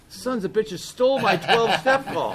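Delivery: high, loud, laughing indignant outburst; hammed-up angry cry at rivals; mock outrage. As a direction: blurt it out half-laughing, indignant. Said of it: "it pissed me off".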